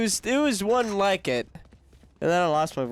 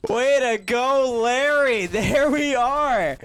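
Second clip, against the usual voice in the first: excited cheer; 6 semitones higher, 4 dB louder, much slower than usual. Real, not acted. Cheering the round win.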